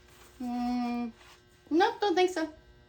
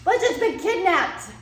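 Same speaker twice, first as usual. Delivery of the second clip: panicking; 5 semitones higher than usual. Acted. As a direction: blurt it out in a panic. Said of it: "Dude, you're panicking".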